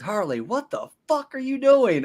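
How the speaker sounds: high-pitched, drawn-out character voice; acted cartoon outburst. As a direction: stretch it out high, imitating an exasperated cartoon villain. Acts out the character's line to Harley.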